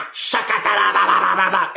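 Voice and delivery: mock tongues babble. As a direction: parody speaking in tongues. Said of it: "do all of that rakatatatata shatata stuff".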